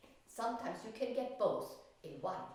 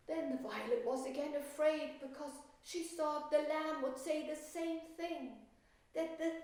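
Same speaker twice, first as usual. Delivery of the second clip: put-on reading to children; 6 semitones higher than usual. Teacher reads story to class.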